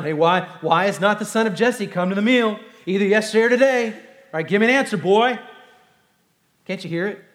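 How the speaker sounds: loud, high booming father's voice; angry king father. Acted. Saul demands his son explain David's absence, booming. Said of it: "I've got a good dad voice".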